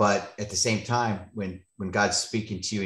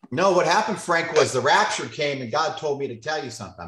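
higher, louder teasing announcement, quickening at the end; mock prophecy; 6 semitones higher, 5 dB louder than usual. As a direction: pretend to deliver a divine message as a joke. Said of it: "I'm playing".

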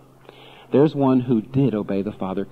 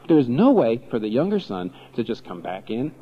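normal talking voice

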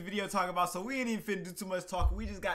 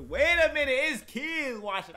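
sudden realization; 7 semitones higher, 7 dB louder than usual. Real, not acted.

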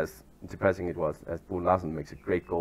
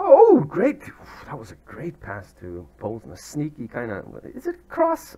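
ordinary talk

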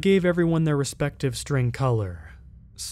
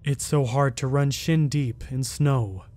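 ordinary delivery

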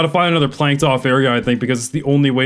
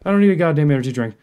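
ordinary talk, nothing marked

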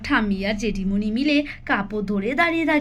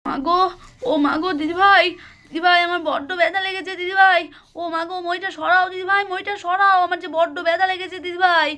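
higher-pitched wail of someone hurt; acted pained wailing; 7 semitones higher than usual. Fallen mother calls to big sister for help, pained high wail. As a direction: cry out for help in pain.

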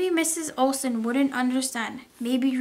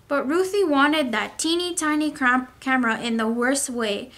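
ordinary delivery, nothing marked

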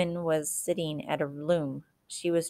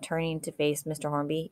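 ordinary delivery

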